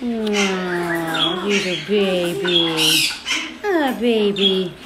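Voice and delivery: slow, drawn-out fond coo; tender cooing. Real, not acted. Fussing over a baby of a bird, slow tender coo. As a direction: stretch each word out adoringly.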